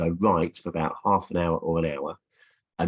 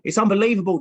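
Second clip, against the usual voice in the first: much higher, louder commentator exclaim; excited TV commentator; 13 semitones higher, 7 dB louder, faster than usual. Retold or imitated. Cartoon commentator talking to Jeff, higher louder amazed call. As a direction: burst out like a pundit who can't believe it. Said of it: "he's saying".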